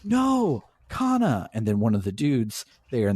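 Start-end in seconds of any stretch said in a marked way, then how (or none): 0.0-1.5 high-pitched, drawn-out mock cry; imitated desperate cry. Imitating June at the elevator as Kana falls, desperate cry. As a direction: call out in hammed-up alarm, pitch leaping up. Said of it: "you hear June go".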